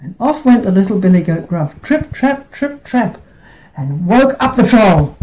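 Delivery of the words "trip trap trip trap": high, soft trip trap; soft hoof steps. A narrator's reading. Little goat crossing the bridge, high and soft. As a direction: tiptoe it lightly.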